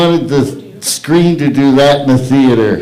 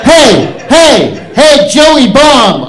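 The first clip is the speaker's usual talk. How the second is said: higher and louder shout; loud hey, stretched slow on the name; calling out; 8 semitones higher, 6 dB louder, much slower than usual. Real, not acted. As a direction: hail the guy across the room. Calling out performer from stage.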